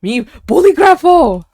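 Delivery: loud, high-pitched mock exclamation; playful shout. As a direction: belt it out with playful gusto. Playful echo of funny word.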